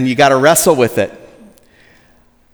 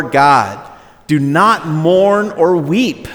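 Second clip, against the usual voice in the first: slow, solemn reading of the passage; scripture reading; 3 semitones higher than usual. Acted. Pastor reading Nehemiah to the congregation, slow and solemn. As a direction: let each verse land slowly.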